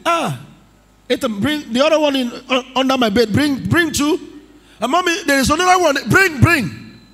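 high, fast excited voice. Retold or imitated. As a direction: hurry everyone to fetch it, thrilled. Preacher voicing excited person fetching gold.

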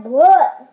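loud disgusted gag; gagging bleh. Real, not acted. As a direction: spit out the taste with a gag. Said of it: "making me gag".

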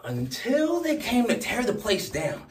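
very high, loud, hurried outburst; frantic shouting. Acted. Speaker tells Jack who found the note, shouting frantically. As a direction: race through it at the top of your voice. Climax of the note's story.

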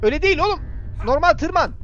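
usual speaking voice

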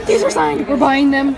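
can't believe the find, grab them; excited shout over signed books; thrilled gasp. Real, not acted.

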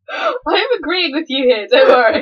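giggling reassurance; laughing. Real, not acted. Laughing while reassuring friend mid-debate.

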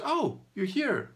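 high, slow, casual invitation; mildly imitated easygoing zookeeper; friend's reply. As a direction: voice a relaxed friend waving you in. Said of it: "he goes".

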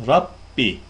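Rabbi with a heavy, thick r and doubled b. Shown as a demonstration. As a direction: recite Rabbi with a full thick ra. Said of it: "Fethalı olan ra kalın okunur".

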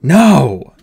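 put-on boy crying out as his friend dies; anguished shout. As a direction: scream it out in denial. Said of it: "shouted Artemis".

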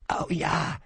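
croaked. A narrator's reading. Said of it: "krächzte ich".

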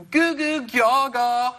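put-on high, slow baby babble; whiny baby goo-goo, drawn out; emo baby. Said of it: "my impression of an emo baby".

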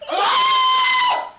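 very high, loud shriek; piercing scream of fright. Real, not acted. Player caught by another jump scare, shrieking. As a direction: shriek in sudden terror.

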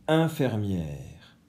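infirmière dit lentement, chaque syllabe détachée; étire infirmière pour faire entendre le son; syllabes étirées. Shown as a demonstration. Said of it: "j'entends un au début du mot". (la maîtresse étire infirmière pour l'élève)

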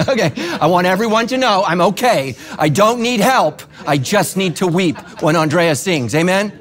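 loud, high, laughing reassurance; laughing excitedly. Real, not acted. Laughing about weeping at concert.

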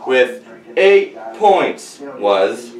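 slow drawn-out reveal; suspense reveal. Acted. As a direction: stretch it before the name. Results reveal.

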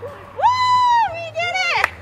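high, loud victory whoop; excited cheer. Real, not acted. Celebrating finished dance.

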